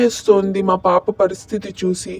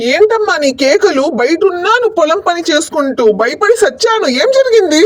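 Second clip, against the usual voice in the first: higher and louder, startled and rushing in; alarmed call; 8 semitones higher, 3 dB louder than usual. Acted. Son runs in from the field at mother's screams, alarmed shouting.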